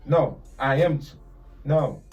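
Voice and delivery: ordinary delivery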